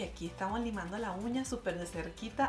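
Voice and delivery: ordinary talk, nothing marked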